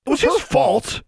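very high-pitched indignant protest; high squawk of accusation; accusing outcry. A narrator's reading. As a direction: blurt out the blame in a high voice. Two students blame each other.

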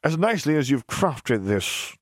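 slow, sneering and condescending. Acted. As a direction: drag it out with contempt. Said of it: "he sneered at the suit".

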